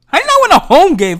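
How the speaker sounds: real fan outrage, 'not' shouted up high; outraged shout. Real, not acted. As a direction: yell it the second time in disbelief. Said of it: "repeated louder".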